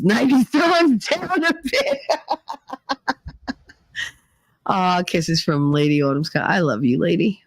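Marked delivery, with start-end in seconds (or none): 0.0-2.0 words breaking up into real laughter; laughing. Real, not acted. Cracks up while reading a comment. 2.0-3.5 high, helpless laugh trailing off; real laughter. Real, not acted. Cracks up at the comment.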